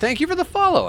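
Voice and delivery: high sing-song thanks; excited thanks. Real, not acted. Streamer thanking a new follower, high sing-song. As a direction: lift the thanks way up.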